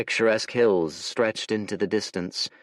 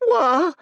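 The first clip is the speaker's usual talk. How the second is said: shrill startled screech; a boy's sudden terrified shriek; 7 dB louder than usual. A narrator's reading. Village boy screeching at a stranger in terror. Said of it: "screeched the boy".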